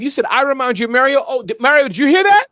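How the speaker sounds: high, loud excited calling out; excited teasing. Real, not acted. Teasing his guest, calling out loudly and excitedly. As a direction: hype it up, call out to Mario.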